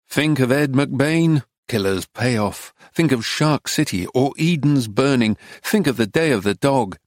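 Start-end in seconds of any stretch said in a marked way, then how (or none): none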